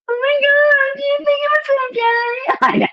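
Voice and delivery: very high anxious squeak; hammed-up nervous squeal after the show. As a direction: panic in a tiny high voice. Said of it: "I was like".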